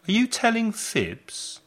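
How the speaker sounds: put-on high, teasing voice to a child; playful scolding parent. Shown as a demonstration. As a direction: tease a child who fibs. Phrase used with children, demo.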